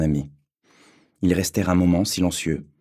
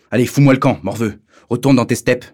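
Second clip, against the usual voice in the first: much higher and louder, contemptuous; bully's shout; 5 semitones higher, 6 dB louder than usual. A narrator's reading. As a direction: bark him off the playground. Bully shouts Alexis away.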